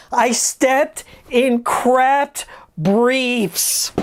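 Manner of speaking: slow, drawn-out disbelief; slow emphatic retelling. Real, not acted. Telling viewers about the shower, slow and drawn out. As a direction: spell it out slowly. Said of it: "repeats punchline very slowly".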